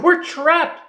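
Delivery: very high, squeaky little cry; Piglet crying out. Acted. Frightened Piglet cries to the gang, very high squeak. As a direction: squeak it out in small panic. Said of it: "cried Piglet".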